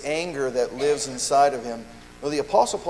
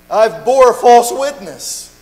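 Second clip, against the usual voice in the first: slow, much louder and higher, a cry of confession; 7 semitones higher, 12 dB louder, much slower than usual. Acted. Believer confessing slander to the congregation, slow loud cry. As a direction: drag it out loudly as a broken admission. Acted confession before the church.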